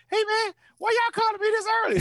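very high-pitched complaint; high scream voice. Retold or imitated. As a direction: squeak it out like Jamel. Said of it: "Jamel got a high scream voice".

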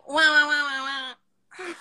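whiny mock wah wah, muffled; wah-wah like a muffled adult voice; muffled wah wah talk. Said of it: "That's so annoying".

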